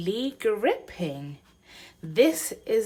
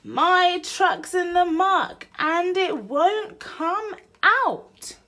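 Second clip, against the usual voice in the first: much higher and louder shout; shouting duck; 11 semitones higher, 7 dB louder than usual. Acted. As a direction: call for help across the water. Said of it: "the ear that hears the shout".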